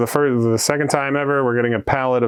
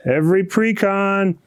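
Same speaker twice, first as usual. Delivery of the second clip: excited; 9 semitones higher than usual. Real, not acted. Hyping every precon in the world.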